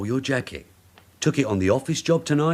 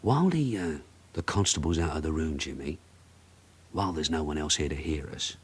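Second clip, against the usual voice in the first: quiet; 7 semitones lower, 7 dB quieter than usual. Acted. Sergeant alone with suspect, confiding.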